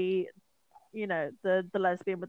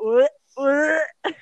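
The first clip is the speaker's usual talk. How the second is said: mock shocked cry; 5 semitones higher, 10 dB louder than usual. Retelling own shocked reaction.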